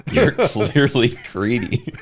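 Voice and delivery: slow drawn-out sneer; mock echo. Friend echoing the Ollivander insult, slow. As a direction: repeat it slowly, savoring it. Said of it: "S2 echoes the insult".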